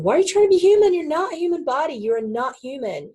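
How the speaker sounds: high, rapid mock protest; exasperated challenge. Teacher answering a pupil who wants to be human, exasperated. Exasperated reply to acted complaint.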